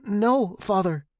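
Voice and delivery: high, caught-out denial; small anxious boy answering his father; nervous boy. A narrator's reading. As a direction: deny it like a scared son.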